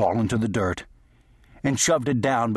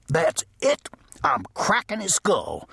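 angry outburst; 8 semitones higher than usual. A narrator's reading. Deputy loses his temper.

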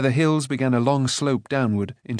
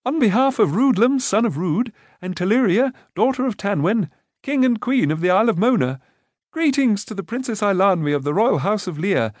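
much higher, formal herald-like greeting; solemn declaration; 9 semitones higher than usual. A narrator's reading. Rune formally greeting the princess. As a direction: announce like a royal herald. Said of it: "in a solemn voice declared".

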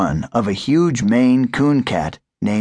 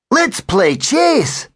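much higher, eager puppy voice; excited puppy; 14 semitones higher, much slower than usual. A narrator's reading. Sir Walter begs to play, high and excited. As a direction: bounce with puppy glee.

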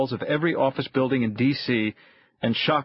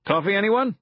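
higher, louder offer of coffee; loudly offering coffee to the room; 7 semitones higher than usual. A narrator's reading. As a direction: call it out over the meeting. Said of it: "Kritz said loudly".

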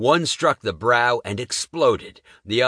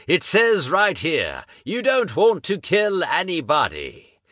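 fiery preacher; 7 semitones higher than usual. Acted. Preacher calls the monster to repent, booming. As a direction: preach at the beast like a tent revivalist.

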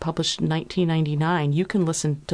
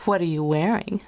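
usual speaking voice

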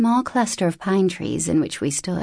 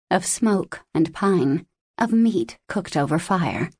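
ordinary delivery

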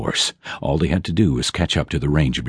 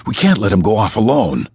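ordinary delivery, nothing marked

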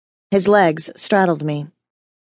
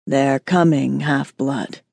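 growling demon; 5 semitones lower, slower than usual. Acted. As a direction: growl it through fangs. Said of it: "he growled around his fangs".

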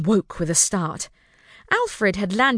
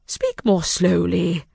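slow, kingly command; 2 semitones lower, slower than usual. A narrator's reading. King calms children.

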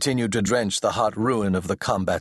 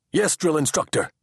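high, crisp answering voice; eager assistant; 8 semitones higher than usual. A narrator's reading. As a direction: snap back at the superior.

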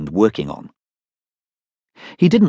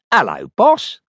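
Dud Check's voice; 6 semitones higher, slower than usual. Acted. Voice on the phone.

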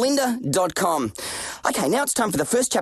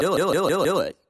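echo voiceover; 3 semitones lower, much faster than usual. Shown as a demonstration. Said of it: "it's just a voiceover technique".

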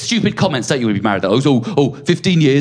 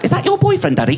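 cheeky high daughter tease. Retold or imitated. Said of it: "Martha would pop up".

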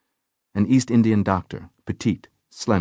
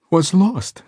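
trembling voice; 12 semitones higher, 5 dB louder than usual. A narrator's reading. Traumatised woman begins her story.

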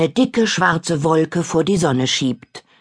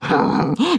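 high witch giggle; 10 semitones higher than usual. Acted. Witch laughs at rival.

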